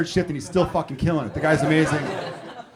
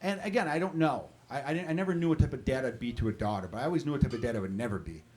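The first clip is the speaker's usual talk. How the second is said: ordinary talk, nothing marked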